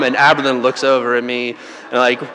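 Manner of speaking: ordinary talk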